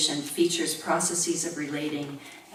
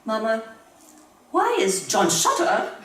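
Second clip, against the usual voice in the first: little boy's high, louder mock British voice; mock British accent; 5 semitones higher, 4 dB louder than usual. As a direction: imitate a small son suddenly sounding British. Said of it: "he had a British accent".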